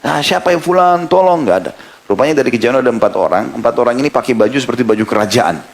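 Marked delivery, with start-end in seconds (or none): 0.0-1.4 calling for help. Retold or imitated. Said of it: "Teriak-teriak".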